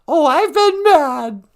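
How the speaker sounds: high, loud, slow protest in the put-on voice; mock indignant. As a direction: insist you've been mad, hamming it. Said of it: "I don't think you've ever been mad".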